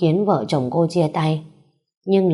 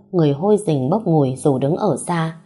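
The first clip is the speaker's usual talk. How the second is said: plain reading voice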